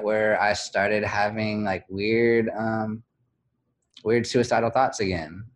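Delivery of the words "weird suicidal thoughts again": normal talking voice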